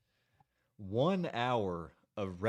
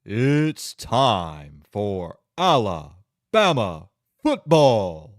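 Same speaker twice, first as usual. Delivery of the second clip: huge, slow, drawn-out announcer boom; stadium announcer call; 14 dB louder, much slower than usual. Shown as a demonstration. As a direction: stretch every word like a PA announcer filling the stadium. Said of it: "No, they don't do it like that".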